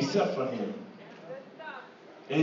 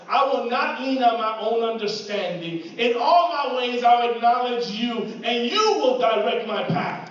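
louder, higher preacher's cry; fervent pulpit preaching, rising and pressing; preaching at full force; 4 semitones higher, 4 dB louder than usual. Acted. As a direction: drive it home to the church.

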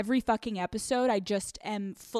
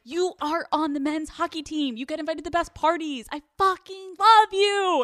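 higher, louder, overeager gushing; mock gushing; 8 semitones higher, 4 dB louder than usual. Imitating college self gushing at hockey boyfriend, higher and loud. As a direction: gush like a fangirl pretending love. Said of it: "I'm like".

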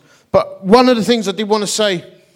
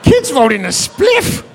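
higher, louder, slow disbelieving exclaim; incredulous; 8 semitones higher, 4 dB louder, slower than usual. Retold or imitated. Vicar retorting to the sugar warning, incredulous. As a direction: blurt it in comic disbelief.

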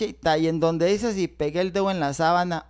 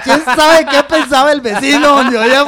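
much louder, higher, laughing; excited loud telling; 6 semitones higher, 11 dB louder than usual. Real, not acted. Telling the friend what the neighbour heard, loud and amused.

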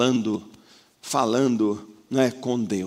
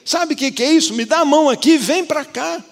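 much higher and louder, urgent rescuing plea; impassioned preaching; 12 semitones higher, 6 dB louder than usual. Acted. God reaching out to save the drowning believer, in fervent high, loud preaching. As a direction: preach like pulling someone from the waves.

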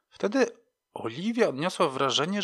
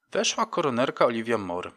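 ordinary delivery

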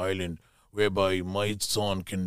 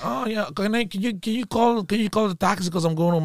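put-on higher-pitched voice for the girl; imitated departing girl; 9 semitones higher, 3 dB louder than usual. Girl asks him to call a taxi, high mimicked voice. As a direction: lift the voice into an imitated request.

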